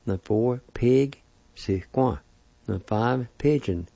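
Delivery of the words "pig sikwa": sikwa said slowly and clearly; slow it down for the review. Shown as a demonstration. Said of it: "I'll pronounce the words one more time".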